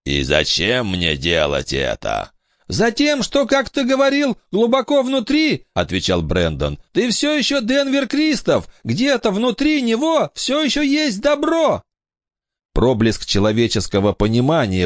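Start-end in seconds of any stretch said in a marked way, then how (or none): 0.0-2.3 lower, darker king's voice; low storm king voice. Acted. 2.7-11.8 put-on very high pleading boy. Brandon answers.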